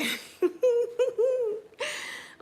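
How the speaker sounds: high-pitched, drawn-out giggle; loud high laughing fit; real laugh. Real, not acted. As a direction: burst into a long, high giggle. Laughs at own eye video.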